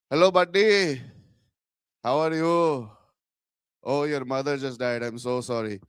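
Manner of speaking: put-on sarcastic Indian-accented sympathy. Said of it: "that certain Indian accent that's just really good".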